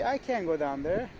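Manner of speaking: usual speaking voice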